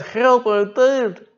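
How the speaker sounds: high, slow, drawn-out whimper; whiny tired plea. Retold or imitated. A tired diver whining to the boat crew, high and slow. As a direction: stretch it out like an exhausted man pleading. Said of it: "when he says".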